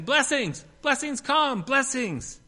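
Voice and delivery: high, loud ecstatic cry to the sky; shouting at the top of her lungs. Retold or imitated. Woman on the beach shouting to the sky, high loud ecstatic cry. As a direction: shout it skyward like a woman possessed. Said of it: "as she continued her shouting".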